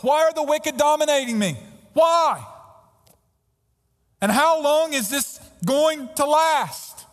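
pleading with God, voice climbing and swelling; pleading outcry. Acted. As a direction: cry out to God, building to a peak on why. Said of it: "Here's the argument".

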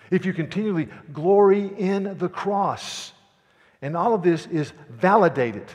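loud, high fervent preaching. Acted. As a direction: preach it at full force. Preaching the sermon at full force.

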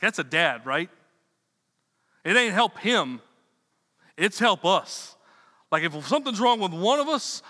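ordinary talk

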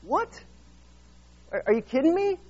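high, sharp "what?" in disbelief. Retold or imitated. Disciples answering Jesus's order, mock shocked. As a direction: react like they can't believe it. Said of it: "They're like".

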